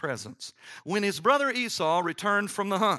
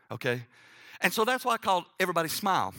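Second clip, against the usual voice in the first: ordinary talk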